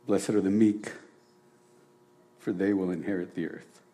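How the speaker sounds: measured solemn scripture reading. Acted. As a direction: read it like holy text, pausing. Reads the Beatitudes aloud.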